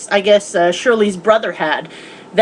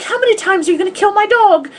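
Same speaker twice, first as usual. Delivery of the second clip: much higher, exasperated quote; exasperated Watson; 9 semitones higher than usual. Retold or imitated. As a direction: complain like a fed-up friend. Watson exasperated at dog being killed.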